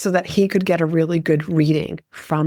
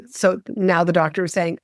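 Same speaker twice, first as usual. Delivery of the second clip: normal talking voice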